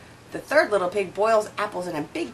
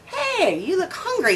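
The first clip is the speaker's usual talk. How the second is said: clever pig; 11 semitones higher than usual. A narrator's reading. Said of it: "he says to the wolf".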